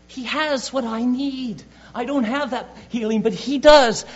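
high, pleading and rising to a loud cry; a sick woman straining toward her last hope; desperate woman. Acted. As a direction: voice her longing, climbing to a shout on the last words. Preacher voices the desperate woman.

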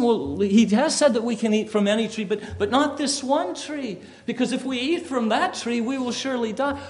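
Eve's reply pitched high; high, earnest voice for Eve correcting the serpent; voicing Eve. Preacher voices Eve answering the serpent, pitched high. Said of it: "she corrects him".

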